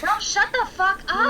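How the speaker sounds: sharp, high angry outburst; angry snap. Acted. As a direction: snap at him to shut up. Kennedy snaps at begging Danny.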